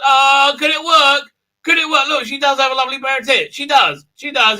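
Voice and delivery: high, loud, fast eager gushing; hammed-up excitement. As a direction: gush like a fool taken in. Villain excitedly fooled.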